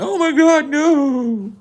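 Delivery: very high, loud wail; hammed-up screaming in panic; mock crying scream. People getting zip tied at arrest, mock crying and screaming. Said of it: "these people are fucking crying and screaming".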